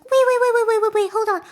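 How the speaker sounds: loud, high, rapid-fire pleading; mock panic, words tumbling out; acted panicked interruption. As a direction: cut in frantically to stop someone. Acts Curly's panicked interruption.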